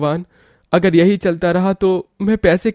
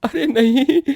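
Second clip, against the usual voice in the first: dismayed cry; 7 semitones higher than usual. Acted. Umbrella flies off, goods ruined.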